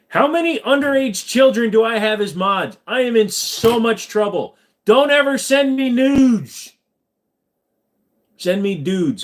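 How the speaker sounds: high, loud alarmed outburst; exasperated shouting. Real, not acted. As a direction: shout it up high, building to a stretched-out cry. Mock panic at young moderators.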